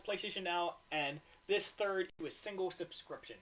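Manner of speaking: reading an article aloud; steady news-report reading. Acted.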